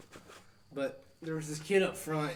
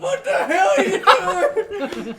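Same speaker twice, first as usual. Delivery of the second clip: very high, loud outraged shout; shocked yell; 14 dB louder than usual. Retold or imitated. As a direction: driver yelling at the kid. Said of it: "Phil was like".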